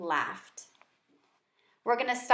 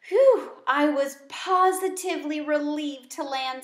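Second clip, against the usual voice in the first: breathless relief, a bit louder; relieved exclamation; 5 semitones higher, 5 dB louder than usual. A narrator's reading. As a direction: blow out a relieved whew. Hamster relieved after leap.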